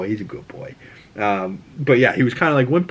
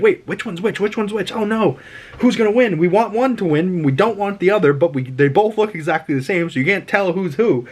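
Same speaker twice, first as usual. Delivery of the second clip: high, excited confusion; anxious viewer; 7 semitones higher than usual. Retold or imitated. Viewer watching the twins fight, high anxious excitement. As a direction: fret over who will win.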